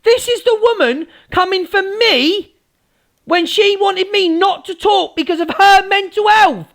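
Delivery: high-pitched angry complaint; indignant rant. Real, not acted. Complaining that Angie is coming for her, indignant rant. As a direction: push it high in real indignation.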